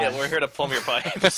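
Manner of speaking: laughing porno line. Retold or imitated. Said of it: "I was gonna make a porno joke".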